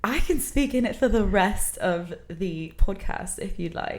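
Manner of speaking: polite, obliging British accent. Shown as a demonstration. Showing the host her practiced British accent on request, British accent. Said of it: "Can I hear your British accent?".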